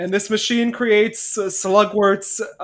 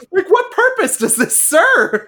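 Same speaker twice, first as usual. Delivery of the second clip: high, loud, laughing disbelief; incredulous protest; 11 semitones higher, 5 dB louder than usual. Acted. Cohost demanding the point of the bugs, incredulous. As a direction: exclaim it through rising laughter. Mock outrage at odd scene.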